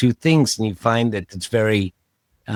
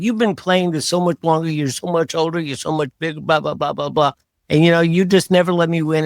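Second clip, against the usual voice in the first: retold boyhood complaint, pitched higher; frustrated teen whine; 7 semitones higher, faster than usual. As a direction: whine it like a frustrated kid losing at tennis. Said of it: "I just got so upset and so frustrated".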